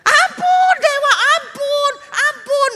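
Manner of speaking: high, loud wailing for mercy; terrified pleading. Acted. Kneeling tribe begs the false god for mercy, wailing. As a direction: beg for your life, shrieking.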